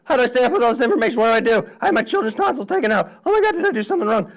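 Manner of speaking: high, fast, panicky mock parent; flustered parent. Parents imagined reacting to all this information, mock panicked. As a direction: rattle it off like an overwhelmed parent. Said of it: "some people are like".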